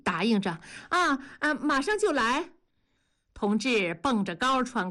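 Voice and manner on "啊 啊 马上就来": suppressing panting. A narrator's reading. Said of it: "文丽压着喘气".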